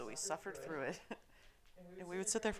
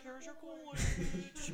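sinister sister; 8 semitones higher, much slower than usual. Retold or imitated. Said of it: "She was like".

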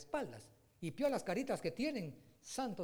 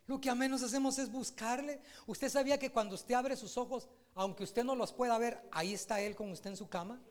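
rising sermon delivery, a little higher; preaching; 3 semitones higher than usual. Acted. Pastor urging the congregation toward God, building fervor.

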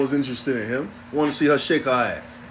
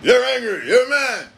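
high-pitched mock accusation; raised, put-on accusing voice; imitated accusers; 8 semitones higher than usual. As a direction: mimic someone crying "angry man" at you.

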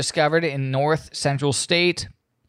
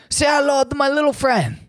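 much higher, put-on Tony Montana movie growl; Scarface impression; 11 semitones higher than usual. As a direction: quote the gangster line in a pitched-up movie voice.

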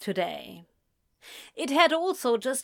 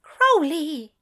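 higher and louder, sharp rebuke; scandalised hiss; 7 semitones higher, 7 dB louder than usual. Acted. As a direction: hiss the name in shock. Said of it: "Aziraphale hissed into the phone".

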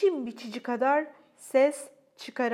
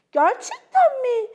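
breathless wonder; 12 semitones higher, 9 dB louder, slower than usual. A narrator's reading. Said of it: "diye sordu Francesca nefes nefese".

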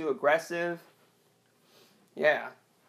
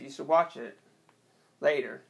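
normal talking voice

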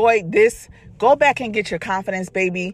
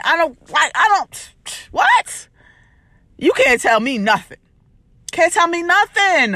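pumped-up boast, pitch climbing higher; self-hype; 3 semitones higher than usual. Pretend. To herself in the mirror, proud boasting self-hype. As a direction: hype yourself up like the best thing alive. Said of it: "pour into yourself and be like".

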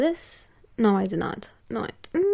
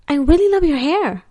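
imitating an eager admirer, higher and louder; admiring compliment; 6 semitones higher, 5 dB louder than usual. Stranger compliments her hair in the mall, imitated gushing. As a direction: gush it as the retold stranger.

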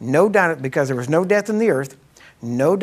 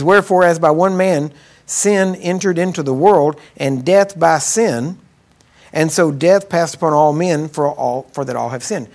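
slow, loud, weighty reading of the verse; preacher reading Romans to the congregation, slow and loud; scripture reading; 4 dB louder than usual. Acted. Preacher reading scripture to his church, slow and loud.

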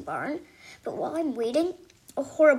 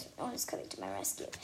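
usual speaking voice

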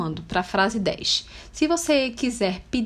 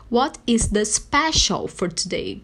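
very slow, evenly spaced English; model phrase; slower than usual. Shown as a demonstration. Teacher repeating the question about the special, very slowly. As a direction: spell it out word by word for learners. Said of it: "você pode dizer assim".

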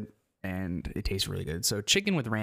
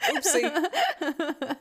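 giggly high oopsie; laughing; 4 dB louder than usual. Real, not acted. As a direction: laugh through it, embarrassed. Caught with wrong camera on.

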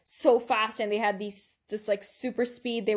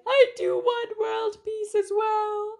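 high, slow, hammed-up crying; mock tearful wail; 12 semitones higher, 4 dB louder, slower than usual. Sandra Bullock imitation at the end of the film, mock crying. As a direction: wail it like an overwrought pageant contestant, badly moved. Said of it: "she gets emotional and she cries".

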